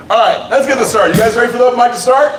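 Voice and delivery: high, fast host shout; rousing the crowd. Real, not acted. Host asks crowd if ready for open mic, rousing shout.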